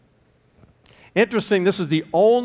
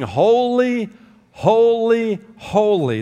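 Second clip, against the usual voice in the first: ringing angelic cry; 7 semitones higher, 4 dB louder, much slower than usual. Acted. Preacher voicing angels singing to shake the temple, loud. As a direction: proclaim it high and full like the seraphim. Angels sing, foundations shook.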